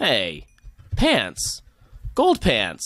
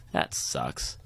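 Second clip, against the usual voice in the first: whispered grumble; 11 semitones lower, 8 dB quieter than usual. Real, not acted.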